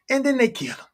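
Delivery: usual speaking voice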